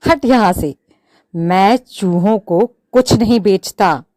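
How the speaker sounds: loud, dismissive get-out; scornful shout. Acted. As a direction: wave the mouse off loudly. Shopkeeper laughs, refuses mouse.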